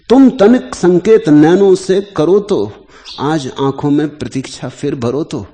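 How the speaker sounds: refrain declaimed high and loud, then easing down; poem recitation. Acted. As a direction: declaim the verse with a strong opening. Repeats the poem's refrain. (ऊंचे स्वर में कविता-पाठ)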